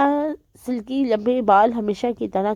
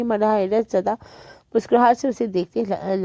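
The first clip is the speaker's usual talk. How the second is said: plain reading voice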